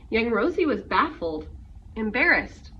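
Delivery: usual speaking voice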